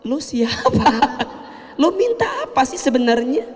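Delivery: high, scornful brush-off with a laugh; amused imitation of a rude boss; mocking boss. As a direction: play the dismissive boss. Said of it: "kata bosnya".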